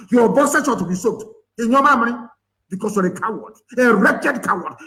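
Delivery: high-pitched, scornful tirade; real indignant rant; angry berating. Real, not acted. Berating cowardly political leaders.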